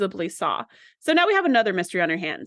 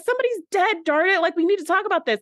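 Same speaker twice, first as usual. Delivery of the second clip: frantic insistence; 8 semitones higher than usual. Retold or imitated. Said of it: "And she's like".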